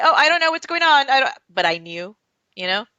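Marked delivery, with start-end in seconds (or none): 0.0-1.3 feigned ignorance. Retold or imitated. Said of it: "lie to myself and say".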